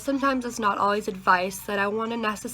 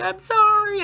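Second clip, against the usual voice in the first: very high, loud wailed "sorry"; mock crying apology; 13 semitones higher, 8 dB louder, slower than usual. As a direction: wail the apology, imitating your sobbing self. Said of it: "it was just a cry fest".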